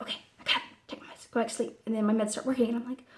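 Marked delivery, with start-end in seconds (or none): 0.0-1.8 acted sleepy whisper; half-asleep. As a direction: barely voice it, as if dozing off. Acts out her half-asleep morning self.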